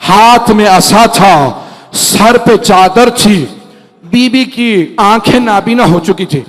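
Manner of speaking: usual speaking voice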